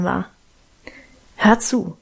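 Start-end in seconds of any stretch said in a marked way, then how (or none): 1.4-2.0 short, mildly bossy order; commanding. A narrator's reading. Guste commands her siblings, bossy. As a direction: order them to listen, firmly. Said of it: "befahl Guste".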